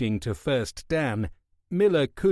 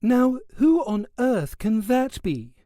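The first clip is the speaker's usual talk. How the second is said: surprised; 11 semitones higher, 3 dB louder than usual. A narrator's reading. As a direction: wonder aloud who could be calling. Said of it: "looked up in surprise".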